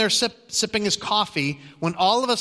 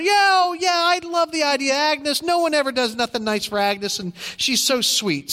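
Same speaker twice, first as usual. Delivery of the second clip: eager delight; 9 semitones higher than usual. Retold or imitated. Cook's wife backing the party idea, gushing excitement. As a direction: gush with delight over the plan.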